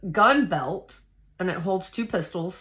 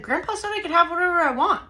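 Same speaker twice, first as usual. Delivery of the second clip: high, quick indignant retort; indignant protest; 11 semitones higher, faster than usual. Retold or imitated. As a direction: protest it high and fast, acting out the argument. Retelling argument with grandmother.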